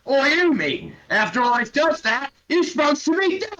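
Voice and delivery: very high, loud outraged orc whine; an orc cheated of his kill, indignant; disappointed orc. Acted. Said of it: "Gormok looks relatively disappointed and goes".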